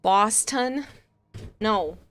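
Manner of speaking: ordinary talk, nothing marked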